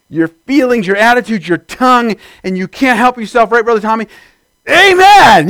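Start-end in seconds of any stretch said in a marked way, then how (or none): 4.7-5.5 high, loud shout of amen; yelled amen. Acted. Preacher showing how agreement bursts out, high shout. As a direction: yell it out like you can't help it. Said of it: "You just gotta yell".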